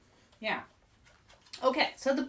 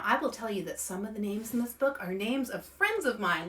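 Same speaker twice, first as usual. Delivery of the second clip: plain reading voice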